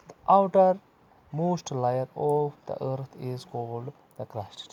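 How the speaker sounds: slow, drawn-out reading of the English textbook line. Acted. Teacher reading the textbook to young pupils, slow and deliberate. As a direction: read it out word by word for the class.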